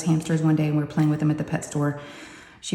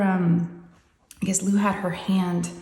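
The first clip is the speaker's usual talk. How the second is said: usual speaking voice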